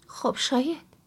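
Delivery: plain reading voice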